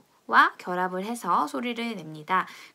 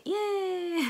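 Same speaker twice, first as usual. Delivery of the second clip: high-pitched little "yay" ending in a laugh; playful cheer; 11 semitones higher than usual. Pretend. Teacher cheering the silent H for students, playful high cheer. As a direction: celebrate playfully, pitch leaping up.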